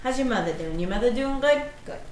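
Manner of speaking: put-on a light Boston accent on your mother. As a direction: lightly play a Boston guy. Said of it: "sound like one of the [name]".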